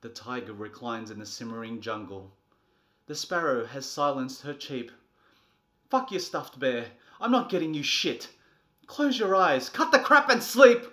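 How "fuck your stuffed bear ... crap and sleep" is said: building to a loud, high scream; furious yelling. Acted. Exhausted parent screaming at the child to sleep. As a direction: lose it completely at the kid.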